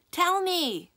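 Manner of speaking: high, loud yell after a truck. Acted. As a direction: shout it after the departing truck. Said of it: "yelled Lainey at the retreating truck".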